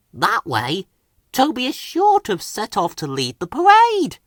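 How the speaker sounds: a little plotting glee; chuffed to himself. A narrator's reading. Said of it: "he chuffed to himself".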